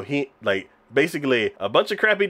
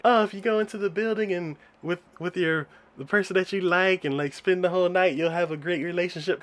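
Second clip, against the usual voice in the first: light, higher mock storyteller voice; mock myth-telling; 6 semitones higher, 4 dB quieter than usual. Telling listeners a romantic myth, lightly mocking. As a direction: recite it high, like a silly rumor.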